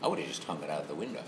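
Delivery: ordinary talk, nothing marked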